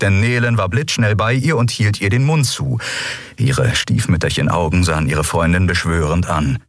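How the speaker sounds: ordinary delivery, nothing marked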